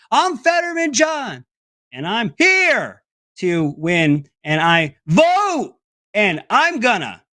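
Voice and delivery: mock halting speech, slow and broken; John Fetterman impression. Host mocking a senate candidate's garbled speech, slow and halting. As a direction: stumble through a stump speech, lost mid-phrase. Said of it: "So this is John Fetterman".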